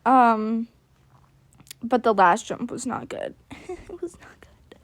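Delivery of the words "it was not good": soft, slow whisper through a laugh; a rueful laughing aside. Real, not acted. Comment then repeated in whisper.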